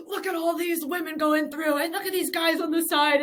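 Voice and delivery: high, loud commentator cracking up; hammed-up commentator calling it with a laugh; excited commentator. Race commentator marveling at women going through, excited. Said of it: "the commentator is literally like".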